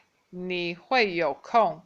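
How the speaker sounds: slow statement without the question word; slow demo. Shown as a demonstration. Teacher showing the statement form, slowly. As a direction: draw it out as a plain statement. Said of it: "If I just say".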